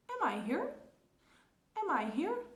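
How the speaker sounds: "Am I here?" with a rising question tune; model question. Shown as a demonstration. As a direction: repeat "Am I here?" clearly with rise. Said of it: "a nossa pergunta fica".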